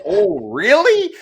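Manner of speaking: loud, high, drawn-out "oh, really"; hammed-up incredulous reply; mock intrigue. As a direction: react with exaggerated disbelief, stretching it out. Acted reaction to player's odd answer.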